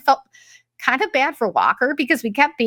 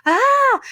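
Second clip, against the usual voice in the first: high mock shriek of panic; imitated panicked scream; 3 dB louder than usual. Mimicking Beckett terrified of bugs, high panicked shriek. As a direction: imitate a bug-phobic scream, pitched way up. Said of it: "He had, like, this panic".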